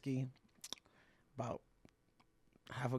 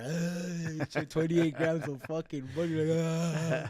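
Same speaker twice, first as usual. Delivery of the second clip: mock wailing cry; 5 semitones higher, 7 dB louder, slower than usual. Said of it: "that violent cry".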